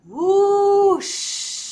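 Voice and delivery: loud, high, drawn-out whoosh; big swooping wind noise; whoosh sound. A narrator's reading. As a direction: imitate a great rush of wings. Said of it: "Owl heard a strange sound".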